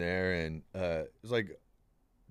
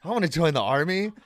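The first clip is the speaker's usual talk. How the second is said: higher, louder eager declaration; hammed-up enthusiasm; mock eager; 7 semitones higher, 5 dB louder than usual. Teen sold on Germany, mock eager. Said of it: "So I was like".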